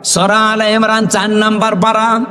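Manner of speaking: ordinary talk, nothing marked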